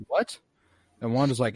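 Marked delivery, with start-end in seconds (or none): 0.1-0.4 surprised disbelief. Retold or imitated. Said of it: "They're like".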